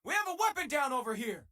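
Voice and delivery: high, urgent call for attention; alarmed shout. Acted. Crew member alerting the ship that a weapon is down, alarmed shout.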